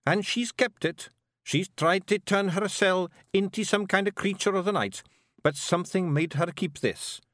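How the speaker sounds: rowdy little Scots pixie voice; broad Scottish accent. Acted. Rob explains.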